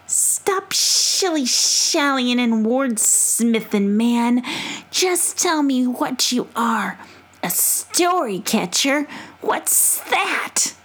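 high, slow scolding possum; impatient possum voice. Acted. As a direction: scold him like a cranky possum. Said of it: "in her possum voice".

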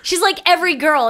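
ordinary delivery

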